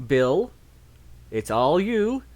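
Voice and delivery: ordinary talk, nothing marked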